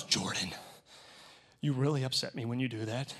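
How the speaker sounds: quiet, measured telling-off in imitation; imitated wife's scolding. Wife to husband after illegal turn, imitated scolding.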